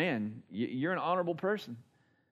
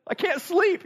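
tearful wife; 5 dB louder than usual. Retold or imitated. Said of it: "and she's crying".

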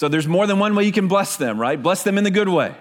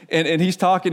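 usual speaking voice

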